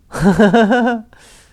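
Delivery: a big, loud, high laugh that bursts out; loud burst of laughter. Real, not acted. Streamer cracking up at a game line, loud real laugh.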